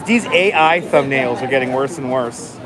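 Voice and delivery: ordinary talk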